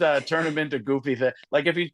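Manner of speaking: ordinary talk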